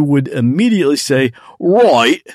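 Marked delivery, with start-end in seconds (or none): 1.6-2.3 put-on a quick punchy "Right." in a light Australian accent; Australian accent, lightly put on; mock Australian accent. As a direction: imitate Dave saying "Right." the Australian way. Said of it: "In your spot-on Australian slash New Zealand slash British accent".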